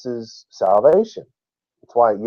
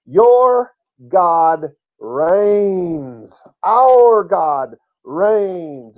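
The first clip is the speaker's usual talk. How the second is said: each word of "God reigns" stretched very slowly; emphatic proclamation; 5 semitones higher, much slower than usual. Real, not acted. Teacher proclaiming the most important verse, emphatic.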